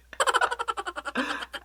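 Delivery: sudden squealing laugh at own joke; high burst of laughter. Real, not acted. Laughs again at own impression.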